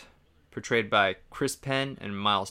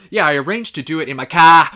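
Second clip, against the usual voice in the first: mock Boston accent, loud and higher; 4 semitones higher, 13 dB louder than usual. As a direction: exaggerate the Boston dropped R, loudly. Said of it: "starts dropping his R's out of nowhere".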